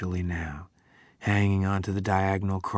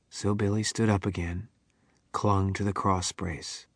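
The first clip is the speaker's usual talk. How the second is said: ordinary delivery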